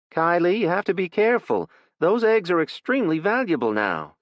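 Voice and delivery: a mom warning her daughter to be careful; mother's warning. Acted.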